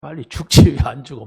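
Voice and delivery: imitating an irritated boy cursing his sick father; hammed-up sulky anger; retold angry outburst. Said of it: "막 이렇게 막 화가 나는 거야".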